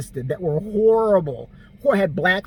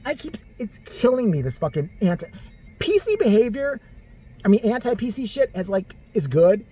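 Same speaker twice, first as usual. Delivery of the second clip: normal talking voice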